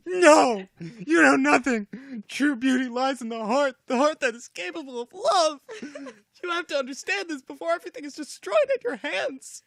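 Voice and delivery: high, loud hammed-up crying hero; crying Archie voice. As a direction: wail it out, high and loud, breaking into laughter. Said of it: "maybe read that in crying Archie voice".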